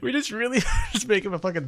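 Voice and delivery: laughing. Real, not acted. Laughing while explaining the joke.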